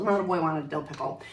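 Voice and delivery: ordinary delivery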